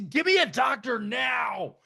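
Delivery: loud, slow yelled order at hospital staff; shouting demand. Retold or imitated. Man yelling at hospital staff for a doctor. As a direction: bark it, demanding a doctor right now.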